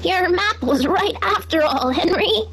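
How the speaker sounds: high, slow praising; sheep voice. A narrator's reading. Sheep tell Henry his map was right, high. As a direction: sheep reassure the pig.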